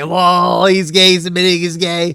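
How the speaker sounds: teasing singsong taunt; mocking taunt. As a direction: jeer it like a troll. Mock taunt.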